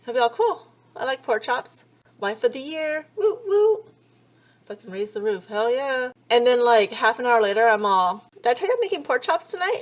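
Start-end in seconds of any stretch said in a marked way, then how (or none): 0.3-3.8 high, hammed-up cheering husband; fake-hype it, voice jumping up; mock cheer. Husband mocking her pork chop news, hammed-up cheer. 8.3-9.8 high, fast, eager question; eager repeat. Retold or imitated. Wife pestering husband about dinner, high eager chirp.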